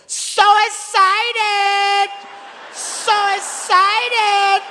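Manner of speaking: high, slow, drawn-out squeal of "So excited!"; mock cheerful ride operator, high and slow; imitating the ride attendant. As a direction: stretch every syllable up high like an overjoyed attendant. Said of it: "He goes".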